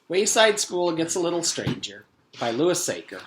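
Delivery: title read to listeners; book announcement. Acted. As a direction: present the book like a host.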